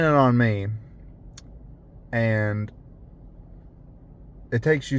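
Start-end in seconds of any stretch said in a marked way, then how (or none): none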